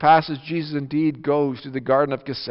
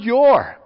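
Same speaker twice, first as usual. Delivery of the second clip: "your" said alone, louder and falling; stress on your; 7 semitones higher, 6 dB louder than usual. Shown as a demonstration. Preacher singling out the word for the congregation, stressed. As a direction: isolate and punch "your". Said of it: "He emphasized that word".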